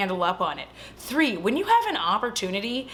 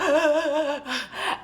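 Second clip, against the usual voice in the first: higher, hammed-up gasping; horrified gasps; 5 semitones higher than usual. Heroine horrified at the envelope, mock panicked gasps. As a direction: imitate panicked, shuddering breaths. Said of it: "she's, like, horrified".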